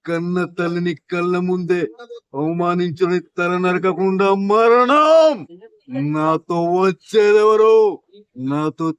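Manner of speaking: slow, loud heroic war cry; menacing movie punchline. Acted. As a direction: declaim it like a warrior king. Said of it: "different సినిమాలు చెప్పు".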